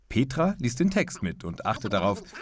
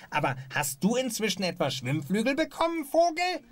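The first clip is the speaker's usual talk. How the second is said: a duckier duck, rising higher to the question; more duck-like. Acted. Duck teasing the bird, more duck-like after a note. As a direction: play up the duck, climbing higher at the end. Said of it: "Viel besser".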